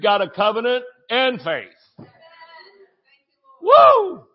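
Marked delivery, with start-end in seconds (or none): 3.6-4.2 a high, loud joyful whoop. Real, not acted. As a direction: let out a big celebratory woo. Preacher whoops mid-sermon.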